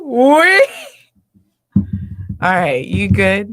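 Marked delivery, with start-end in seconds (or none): 0.0-0.6 loud, drawn-out woo-wee; real emotional exhale, loud and long; overwhelmed whoop. Real, not acted. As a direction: let out a big whoop of release. Overcome after the poem.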